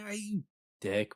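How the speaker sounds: high-pitched mock complaint; mock annoyance. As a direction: whine it up high, as if just splashed. Imagined reaction to being splashed.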